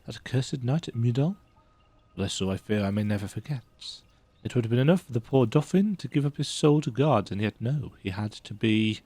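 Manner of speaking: reads the note aloud like a dramatic narrator; dramatic letter reading. Acted. Streamer reading a found letter aloud to viewers, lightly dramatic. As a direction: bring the old letter to life with some flair.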